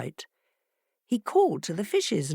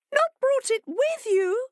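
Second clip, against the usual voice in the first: much higher, alarmed fish; fish crying in alarm; 3 dB louder than usual. A narrator's reading. Fish learning the heart is at home, high alarm. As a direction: squeak it in dismay. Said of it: "the fish cried in alarm".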